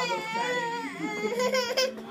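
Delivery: high, slow, laughing mock coo; mock doting voice. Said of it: "this is all I heard".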